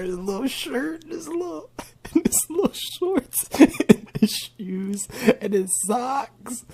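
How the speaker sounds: high, slow squeal through giggles; giggling. Real, not acted. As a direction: lose it laughing between phrases. Giggling while cooing over him.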